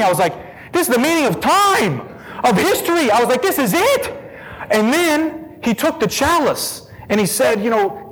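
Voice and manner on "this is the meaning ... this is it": high-pitched, amazed exclamation; retold burst of astonishment; excited revelation. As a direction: let the voice climb with each discovery. Retelling his moment of revelation.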